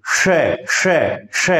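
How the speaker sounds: श repeated with the tongue curled back; retroflex sh demo. Shown as a demonstration. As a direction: sound out श several times. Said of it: "ये क्या है".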